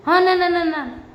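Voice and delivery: high, loud rushed "no, no, no"; excited protest. Real, not acted. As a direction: jump in high and loud to object. Vlogger protests Farah's remark.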